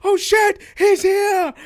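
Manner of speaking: high, loud excited shout; voice from the back. Acted. Game master as onlooker spotting an arrival, shouting excitedly. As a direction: yell it over a crowd. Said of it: "you hear one voice in the back go".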